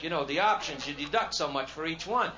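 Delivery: ordinary talk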